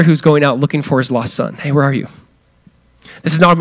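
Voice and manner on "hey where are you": soft, gentle calling out; tender father. Shown as a demonstration. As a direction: call gently like a loving father looking for his son. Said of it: "hear the father heart of God".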